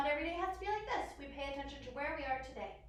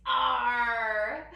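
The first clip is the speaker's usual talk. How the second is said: loud, drawn-out pirate arrr; 11 dB louder than usual. Pretend. Teacher delivering a pirate joke, loud playful arrr. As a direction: growl it out long like a pirate. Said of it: "pirates have a favorite letter".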